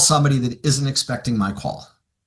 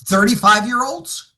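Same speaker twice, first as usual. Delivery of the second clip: ordinary delivery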